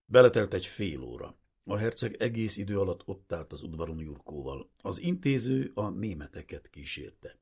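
ordinary delivery

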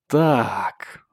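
menacing hissed word; sinister hiss. A narrator's reading. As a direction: hiss it like a villain's threat. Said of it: "зловеще прошипел Чейд".

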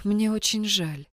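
soft, slow sorrowful; sympathetic. A narrator's reading. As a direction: say it gently, with pity. Kai sympathizes.

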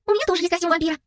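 high, excited announcement; thrilled girlish outburst; exclaiming. A narrator's reading. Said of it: "воскликнула Анника".

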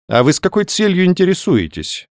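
slightly cold, guarded question; rather cool. A narrator's reading. Doctor questions the young man's purpose, rather cool. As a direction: ask with understated coolness. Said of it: "холодновато спросил доктор".

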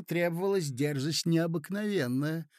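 plain reading voice

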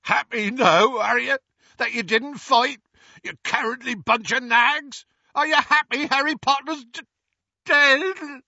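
high, loud tearful yelling; grieving shout. Acted. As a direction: bellow it through grief at them. Hagrid grieving, shouting at centaurs.

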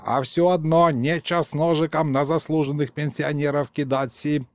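put-on a rambling elderly grandfather, proud of himself; old grandpa storyteller. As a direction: tell it like a folksy old man, savouring every word. Grandpa's indignant speech.

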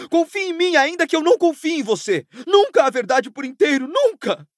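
high-pitched, strained angry rant; impassioned outburst, voice pushed high; furious tirade. Acted. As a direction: let it pour out at breaking point. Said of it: "Sua voz quebrou com o esforço".

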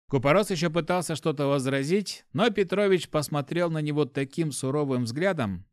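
ordinary delivery

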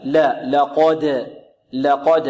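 thin light لا, then heavy ق in لقد, slowly; ترقيق اللام. Shown as a demonstration. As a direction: keep the ل of لقد light before a dark ق. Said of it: "ورقق اللام". (لام لقد مرققة وقافها مفخمة)

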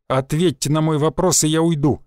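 ordinary delivery

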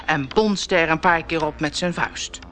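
ordinary delivery

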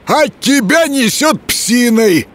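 high, slow offended retort. A narrator's reading. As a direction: snap back, offended. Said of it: "обиделся Леший".

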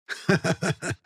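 piping mocking laugh; high squeaky laugh. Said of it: "Сермет громко засмеялся".

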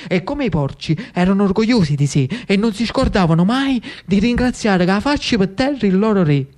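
ordinary delivery

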